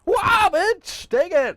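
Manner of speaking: yelling battle cry; shout. Pretend. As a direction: scream it like charging in. Acting out charging knee attack.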